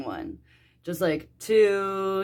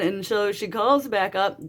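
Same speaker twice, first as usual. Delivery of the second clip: usual speaking voice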